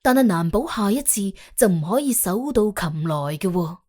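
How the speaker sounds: plain reading voice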